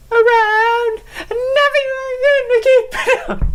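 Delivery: very high, slow, booming hype voice; epic announcer voice. Pretend. Podcaster hyping himself as the Crownator, high and slow.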